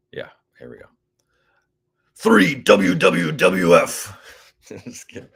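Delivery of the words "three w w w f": slow, loud announcer reading; hammed-up on-air call; radio voice. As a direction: boom it out like a radio host. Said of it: "that was my little attempt at that".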